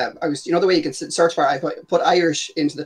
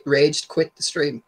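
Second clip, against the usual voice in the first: slow repeat; much slower than usual. Real, not acted. Co-host repeats his question slowly after the guest mishears. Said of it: "Sorry, what'd you say?".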